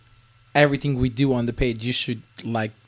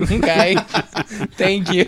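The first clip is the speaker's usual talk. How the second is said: laughing it off, louder and higher; 6 semitones higher, 6 dB louder than usual. Retold or imitated. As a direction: laugh through a curt thanks. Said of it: "I'm like".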